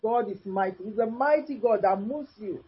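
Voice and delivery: normal talking voice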